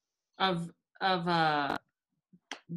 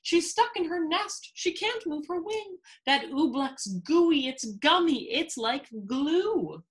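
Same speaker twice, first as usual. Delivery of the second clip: high, urgent storybook voice; acted alarm, pitched well up; crying out; 8 semitones higher than usual. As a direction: cry it out in mounting dismay. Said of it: "Bartholomew cried".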